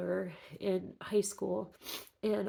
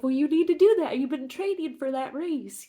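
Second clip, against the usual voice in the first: much higher and louder scolding; scolding sister; 6 semitones higher, 9 dB louder than usual. Retold or imitated. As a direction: mimic an annoyed big sister telling her off. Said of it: "she was so pissed off at me, 'cause she was like".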